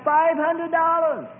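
high, drawn-out answering holler; slow, stretched-out high shout, imitating the boss; yelling back. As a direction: call the price back across the store. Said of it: "And he yells back".

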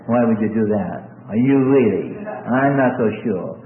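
low, mildly doubting voice; suspicious adult. Acted. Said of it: "they look narrowly at you".

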